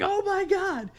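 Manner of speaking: very high-pitched horrified cry; retold freak-out. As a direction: freak out way up in falsetto. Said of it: "I freaked the fuck out".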